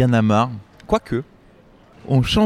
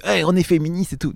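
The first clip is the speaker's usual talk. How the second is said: higher-pitched mock slogan voice; mock feminist proclamation; 7 semitones higher than usual. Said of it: "entre celui qui fait". (slogan féministe parodié, voix plus aiguë)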